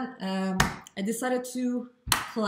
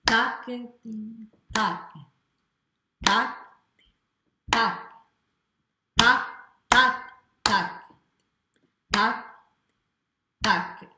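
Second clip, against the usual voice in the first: each "tac" hit louder on the beat; accent on ta; 4 semitones higher, 6 dB louder, much slower than usual. Shown as a demonstration. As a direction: punch every "tac" in strict time. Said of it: "putting emphasis on the ta".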